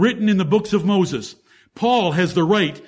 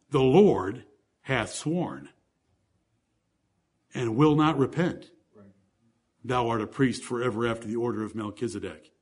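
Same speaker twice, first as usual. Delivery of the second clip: hushed solemn reading; 8 semitones lower, 7 dB quieter than usual. Acted. Solemn quoted oath, softly read.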